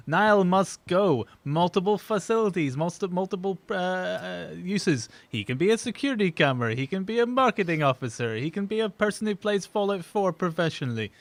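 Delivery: high, loud mock sales pitch; home shopping presenter. Selling a friend on a shopping channel, hard-sell. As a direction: sell him like a TV bargain. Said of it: "a sh-, home shopping channel".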